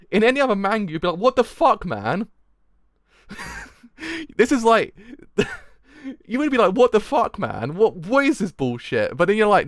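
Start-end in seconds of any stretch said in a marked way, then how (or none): 1.2-2.3 loud, high, acted indignation; mock outrage. Imagined reader of any other manga, mock outrage. 6.7-9.2 acted indignation, starting loud and high; mock outrage. Imagined reader fed up with the bullshit, mock outrage.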